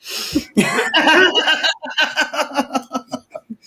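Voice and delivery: a big burst of real laughter; cracking up at his own story. Real, not acted.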